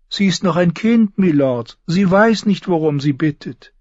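high, loud soothing plea; smooth it over for the child; pitying courtier. A narrator's reading. Varys excuses Sansa to the lords, pitying. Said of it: "seufzte Varys".

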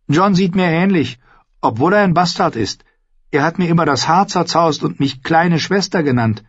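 put-on high, eager girl's voice; young Arya. Arya tells Ned about her brother, high and eager.

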